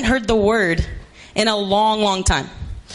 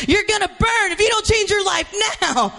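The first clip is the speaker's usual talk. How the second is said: mock hellfire preacher; 9 semitones higher than usual.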